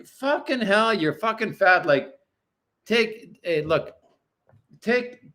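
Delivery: ordinary delivery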